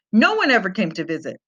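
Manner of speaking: usual speaking voice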